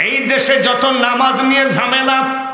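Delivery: high, sustained preacher's delivery; preaching proclamation. Real, not acted. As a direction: declaim it high to the congregation. Returns to preaching in raised voice.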